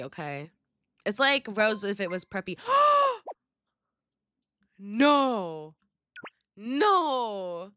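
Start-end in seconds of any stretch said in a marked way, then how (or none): 2.6-3.3 sharp, loud, high gasp; big dramatic intake of breath; shocked gasp. Real, not acted. Shocked reaction to vote. 4.8-7.7 dramatic dismay. Real, not acted.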